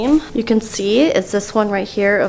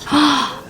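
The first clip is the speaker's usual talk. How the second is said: sharp, higher gasp of delight; 4 semitones higher than usual. Real, not acted. Crafter seeing the shoes done, delighted gasp. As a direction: gasp at how cute it is. Reacts to cute shoe bows.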